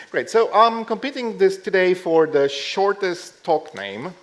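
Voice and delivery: ordinary talk, nothing marked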